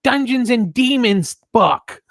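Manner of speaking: high, loud, drawn-out Long Island mom; exaggerated nagging mom in Long Island accent. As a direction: scold like a Long Island mother. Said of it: "Long Island accent".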